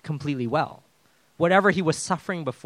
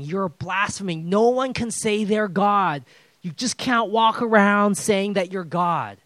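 higher, louder and slow, scolding; indignant Pharisees; 5 semitones higher, 4 dB louder, slower than usual. Retold or imitated. Pharisees scolding Jesus for calling himself God, indignant and louder. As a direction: scold him for claiming to be God. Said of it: "And they hated it because he's saying".

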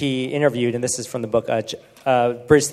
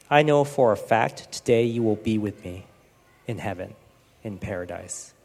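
tender reassurance; 6 semitones lower, 6 dB quieter than usual. Retold or imitated. Jesus consoling robber on cross.

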